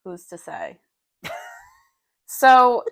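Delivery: ordinary talk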